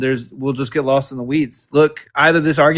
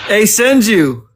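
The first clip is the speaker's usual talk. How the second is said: laughing; 6 semitones higher, slower than usual. Real, not acted. Laughing after the chat insult.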